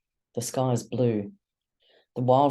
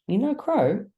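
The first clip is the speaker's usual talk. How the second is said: surprised; 11 semitones higher than usual. Acted.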